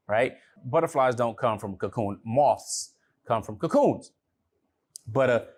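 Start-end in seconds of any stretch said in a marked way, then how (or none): none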